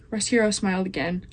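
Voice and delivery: ordinary delivery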